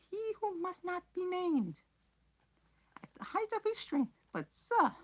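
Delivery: very high squeaky elf voice, loud; high elf voice, hoarse and urgent; hoarse house-elf. Acted. Dobby's voice.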